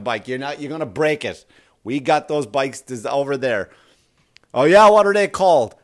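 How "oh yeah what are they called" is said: loud, higher eager question; eager customer. Pretend. Heavy customer asking the salesman, loud and eager. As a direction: perk up with interest. Customer asks, voiced loudly.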